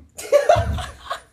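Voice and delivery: high, breathy burst of laughter; real high giggle. Real, not acted. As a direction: crack up at your own joke. Laughs at own ringtone plan.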